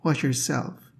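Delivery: teacher reading a poem line slowly to students, drawn out. Shown as a demonstration. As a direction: read the line slowly, letting the vowels ring. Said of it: "For example, in the second stanza".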